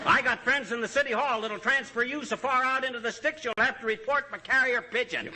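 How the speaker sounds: indignant outburst. Acted. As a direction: tell him off, outraged and half laughing.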